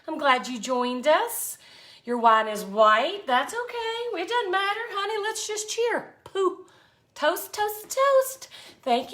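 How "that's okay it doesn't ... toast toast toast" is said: sweet high toasting voice. Pretend. Toasting viewer Dot in live chat.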